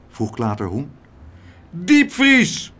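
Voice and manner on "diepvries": loud, high-pitched roar; bellowing. A narrator's reading. Meneer Tump answers Klaterhoen's puzzled question, bellowing. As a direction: boom it out triumphantly. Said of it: "bulderde meneer Tump".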